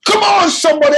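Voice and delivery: booming preacher shout; loud preacher voice. Shown as a demonstration. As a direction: call out to the crowd. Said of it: "I'm wrestling with being so loud".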